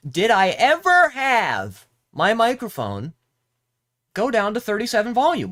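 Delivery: high, loud disbelieving rant; exasperated yell. Real, not acted. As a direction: shout at the broken mic in outrage. Outraged at mic turned down.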